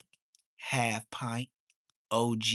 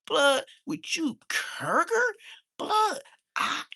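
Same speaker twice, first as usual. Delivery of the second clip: mock outraged Blood; 4 dB louder than usual. Mocking a Blood saying Kurger.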